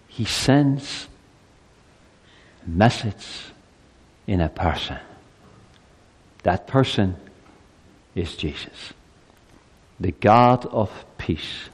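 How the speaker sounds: usual speaking voice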